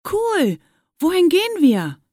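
high, loud, delighted question; eager excitement. A narrator's reading. Fabian just allowed to join his classmates, eager and excited. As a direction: burst out eagerly with pitch leaping up. Excluded boy is invited along.

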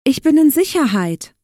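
high, loud piglet exclamation; relieved joy. A narrator's reading. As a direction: cheer it out as the rescued little pig. Pig rejoices at being safe.

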